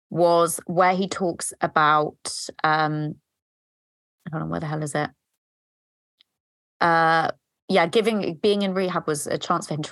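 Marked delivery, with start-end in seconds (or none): none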